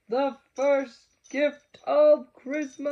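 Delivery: loud, high, slow ringing call; Santa's proclamation. A narrator's reading. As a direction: announce it to the whole crowd of elves. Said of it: "called out".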